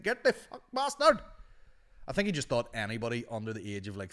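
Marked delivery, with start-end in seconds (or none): 0.0-1.2 high, loud mock-furious shout; hammed-up screech at a customer; angry shopkeeper yelling. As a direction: yell it like a raging shop owner. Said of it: "He's like".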